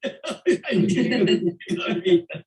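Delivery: loud burst of laughter; hearty laugh. Real, not acted. Amused by host's joke.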